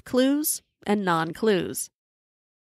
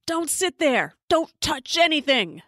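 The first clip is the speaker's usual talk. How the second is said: higher-pitched scolding yell; mock yell; 7 semitones higher than usual. A narrator's reading. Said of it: "yells at me".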